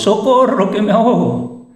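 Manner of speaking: high, loud panicked shouts for help; desperate cry for help. Retold or imitated. A trapped person calling to rescuers, high loud panicked yells. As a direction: scream for help as if drowning. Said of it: "oyen la voz".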